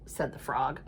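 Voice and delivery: ordinary delivery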